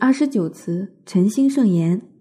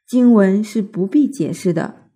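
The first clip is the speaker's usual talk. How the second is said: usual speaking voice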